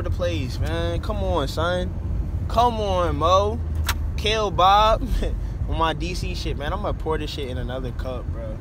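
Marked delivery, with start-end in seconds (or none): none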